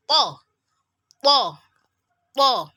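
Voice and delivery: "po" thick, tongue pressed to front teeth. Shown as a demonstration. Teacher drilling the letter, thick "po" with tongue pressed. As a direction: hit each "po" hard and separately. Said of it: "the tip of your tongue would lean against".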